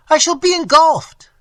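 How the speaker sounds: high, loud terrified wail. Acted. Moneylender being engulfed wails for help, loud terrified wail. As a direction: scream it in panic. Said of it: "he wailed".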